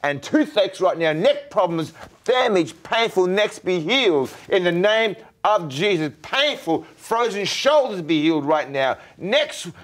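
high, loud commanding prayer; fervent healing prayer. Real, not acted. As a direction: pray with full force, commanding each ailment to leave.

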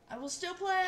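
high, loud declaration; defiant vow. Real, not acted. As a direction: raise the voice and pitch in defiance. Defiant vow after failing in game.

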